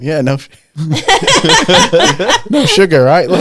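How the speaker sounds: laughing through the words; giggling, barely getting it out. Real, not acted. Telling the nurse about the bleeding while laughing.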